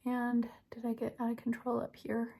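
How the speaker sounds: whispered self-check; whispering. Real, not acted. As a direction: whisper the question to yourself. Checking mistake in mirror.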